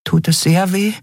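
high-pitched, careful little question; cautious Nelen. A narrator's reading. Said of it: "fragte Nelen vorsichtig". (vorsichtig fragend, mit hoher Stimme)

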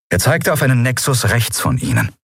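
plain reading voice

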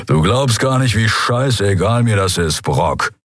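a hint of gruff indifference; grumbling Skria. A narrator's reading. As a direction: grumble it, not caring at all. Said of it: "brummte sein Skria-Kumpan".

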